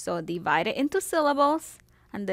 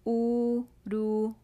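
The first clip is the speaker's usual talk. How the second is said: Uruguay slowly, u-ru-guay; slow syllables; 3 semitones higher, slower than usual. Shown as a demonstration. Teacher splitting Uruguay into syllables. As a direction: divide Uruguay gently. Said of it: "And the Y there counts as an I".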